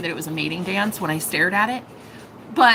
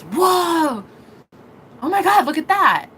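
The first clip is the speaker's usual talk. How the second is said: higher-pitched mock holler of wonder; excited retold shout; imitated amazed yell; 6 semitones higher, 3 dB louder than usual. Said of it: "he yelled it pretty loud".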